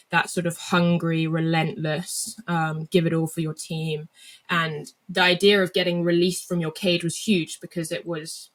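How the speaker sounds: ordinary talk, nothing marked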